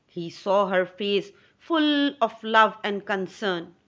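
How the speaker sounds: plain reading voice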